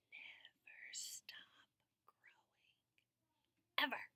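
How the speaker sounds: unvoiced whisper. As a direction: breathe the words out softly. Whispered dictation.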